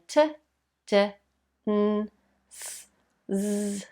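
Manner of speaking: "t, d, n, s, z" as separate sounds, not letter names; isolated speech sounds. Shown as a demonstration. Speech teacher showing tongue placement, "t, d, n, s, z" as bare sounds. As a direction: make each of "t, d, n, s, z" alone, pausing between. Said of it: "they can try making the".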